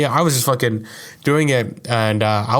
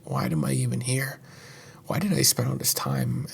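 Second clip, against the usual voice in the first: depressed murmur; 8 dB quieter than usual. Retold or imitated. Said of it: "you're just like".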